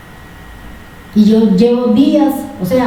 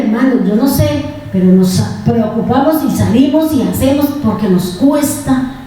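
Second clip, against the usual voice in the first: ordinary delivery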